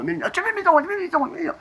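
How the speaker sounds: very high squeal; piercingly high mock voice; squeaky put-on voice. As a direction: squeak it out in a tiny cartoon voice. Squeaky acted voice amid a rant.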